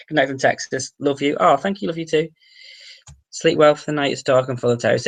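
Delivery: usual speaking voice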